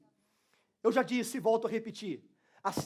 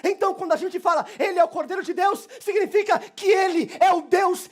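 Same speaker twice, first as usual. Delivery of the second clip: a fired-up pastor shouting the gospel; preacher's climax, loud and higher; full-force preaching; 6 semitones higher, 7 dB louder than usual. Acted. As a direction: preach it loud and high, driving hard.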